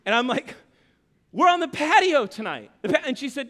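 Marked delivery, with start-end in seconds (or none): 1.3-2.7 loud, higher exasperated protest; incredulous. Retold or imitated. Husband stunned by the patio booking, incredulous. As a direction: protest in disbelief, pushing the volume.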